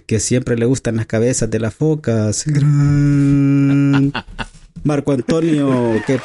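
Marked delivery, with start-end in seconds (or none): 2.5-6.0 hype announcer. Acted. Announcing a guest on the podcast.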